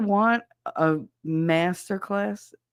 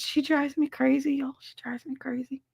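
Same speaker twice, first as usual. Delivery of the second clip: whispered venting, fading softer; exasperated whisper; 7 semitones higher, 4 dB quieter than usual. Retold or imitated. Daughter venting about her mother, exasperated whisper. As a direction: whisper it, at wit's end.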